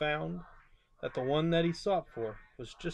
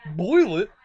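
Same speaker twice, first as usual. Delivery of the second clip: louder, aghast question; shocked gasp; 8 dB louder than usual. A narrator's reading. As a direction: gasp in horror. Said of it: "gasped Horton".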